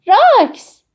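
high, loud cry of a name; shout. Acted. Anouk running forward to greet Roux, shouting. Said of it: "آناک جلو آمد و فریاد زد".

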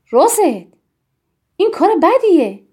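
stern reprimand. A narrator's reading. Tiri scolds little Rosette at the table, stern reprimand. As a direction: reprimand a child firmly.

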